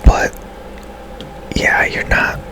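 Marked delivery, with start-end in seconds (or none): none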